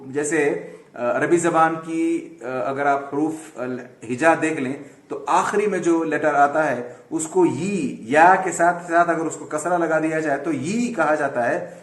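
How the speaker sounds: ordinary delivery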